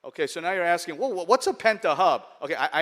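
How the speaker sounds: normal talking voice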